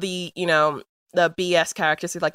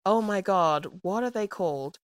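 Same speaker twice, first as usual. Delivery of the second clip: ordinary talk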